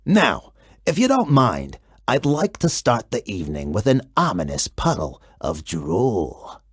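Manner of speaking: very low, rumbling monster voice; deep monster Gabe. A narrator's reading. Gabe the monster greets the kid, very low and rumbling. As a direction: speak from deep under the bed like a friendly beast.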